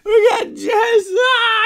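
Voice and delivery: real, very high, loud, slow, voice breaking into tears; so happy you can barely form the words; overwhelmed crying joy. Real, not acted. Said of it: "I can't even talk".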